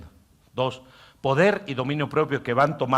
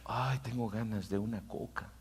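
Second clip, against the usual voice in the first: softer, hushed voice of a craving inner self; inner man craving; 10 dB quieter than usual. Acted. Pastor voicing the inner man wanting a soda, hushed. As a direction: murmur the craving like a private whim. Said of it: "el hombre interior dice".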